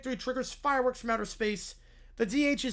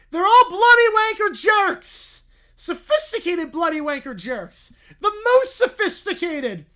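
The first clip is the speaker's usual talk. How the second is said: put-on higher, louder childish rant; kid ranting; 6 semitones higher, 10 dB louder than usual. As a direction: rant at the board like an outraged kid. [name] ranting at the board.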